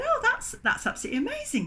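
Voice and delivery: quick, high, delighted gush; excited. Retold or imitated. Baker hearing about the bun tradition, excited and quick.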